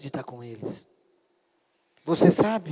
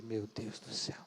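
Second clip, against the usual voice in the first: low, barely voiced aside; hushed dismay; 8 semitones lower, 18 dB quieter than usual. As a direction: murmur it in mock dismay. Reação chocada ao ouvir "grito. (desabafo baixinho, espantado)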